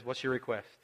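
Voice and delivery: soft, patient question; gentle. Retold or imitated. Jesus gently answering the two disciples, soft and patient. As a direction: ask it softly, with all the patience in the world. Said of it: "He's so gentle".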